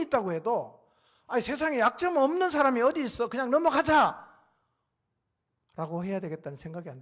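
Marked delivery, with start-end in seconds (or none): 1.3-4.2 loud, raised-pitch waving away of faults; emphatic dismissal. Acted. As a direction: argue loudly to let it slide. Acted quote excusing someone's faults.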